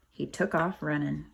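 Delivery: ordinary delivery, nothing marked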